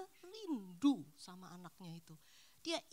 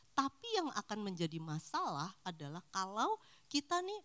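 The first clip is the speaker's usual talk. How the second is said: normal talking voice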